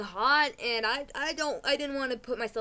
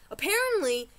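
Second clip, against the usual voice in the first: ordinary talk, nothing marked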